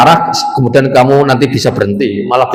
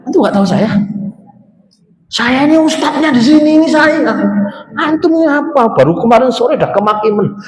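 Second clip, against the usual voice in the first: high, haughty mock preacher; high voice of a smug cleric; arrogant ustadz; 10 semitones higher than usual. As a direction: look down on the listener from a great height.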